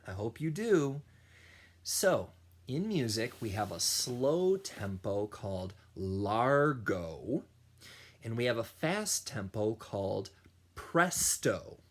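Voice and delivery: plain reading voice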